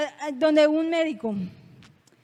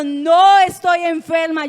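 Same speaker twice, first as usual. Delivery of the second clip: loud, slow, defiant denial; réplica desafiante; 10 dB louder, slower than usual. Retold or imitated. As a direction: contradict forcefully, stretching the words. Contradice a la bruja. (réplica fuerte y desafiante)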